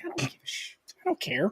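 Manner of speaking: retold, hushed "I don't care" in a mock whisper; whispered defiance. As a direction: shrug it off under his breath. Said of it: "I was like".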